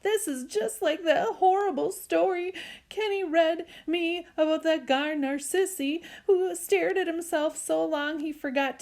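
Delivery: put-on high, tearful little-kid voice; blubbering child. Joey begs Mommy to save Byron, blubbering. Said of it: "Joey blubbered out".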